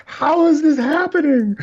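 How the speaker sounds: high, panicked mock voice of a shocked voter; hammed-up disbelief. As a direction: squeal it like a panicking pundit.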